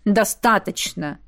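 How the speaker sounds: slow, clipped final word; curt cutoff. A narrator's reading. Lady Gorgani cuts the gnomes off, curt and cold. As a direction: cut them off sharply. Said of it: "отрезала леди Горгани".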